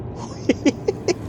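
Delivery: high breathy laugh of delight. Real, not acted. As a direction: laugh in happy surprise. Laughs at accurate result. (หัวเราะอย่างดีใจ)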